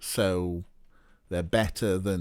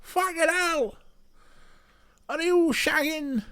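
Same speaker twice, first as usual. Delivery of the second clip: very high-pitched Swansea caricature; hammed-up Swansea accent, far above normal pitch. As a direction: screech it up high like a cartoon Swansea local. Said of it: "'Cause they go".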